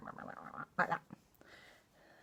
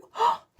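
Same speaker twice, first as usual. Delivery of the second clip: delighted gasp; 8 dB louder than usual. Acted. Amazed at the magic.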